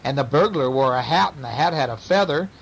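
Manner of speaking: ordinary delivery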